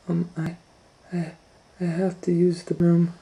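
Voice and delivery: put-on high, halting little girl voice; nervous girl needing the bathroom; Kate, timid. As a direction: stammer it out like a shy child. Kate asks shyly.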